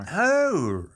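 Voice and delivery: high, drawn-out Australian "No."; Australian accent. Shown as a demonstration. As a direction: exaggerate the Australian vowel in "No.". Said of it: "Ah, God, I lost it".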